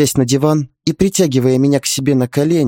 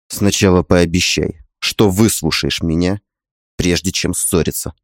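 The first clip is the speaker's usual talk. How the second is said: low male voice; 6 semitones lower than usual. A narrator's reading. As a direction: voice the husband low and tender. Husband voiced in story.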